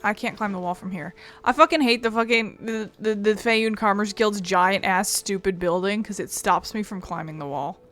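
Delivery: ordinary talk, nothing marked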